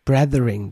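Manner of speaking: Brethering with an English th; English-style Brethering. Shown as a demonstration. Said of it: "die liest du automatisch". (Brethering englisch ausgesprochen)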